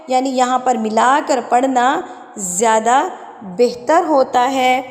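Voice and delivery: usual speaking voice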